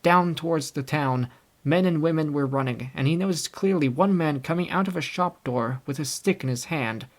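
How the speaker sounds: ordinary delivery, nothing marked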